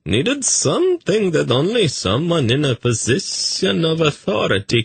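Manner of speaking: a languid courtier recounting; high, languid eunuch narrator; dreamy eunuch. A narrator's reading. Tzadi continues his story.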